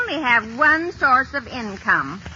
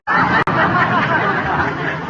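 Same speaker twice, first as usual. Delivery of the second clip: laugh; 6 semitones higher, 4 dB louder than usual. Acted. [name] laughs.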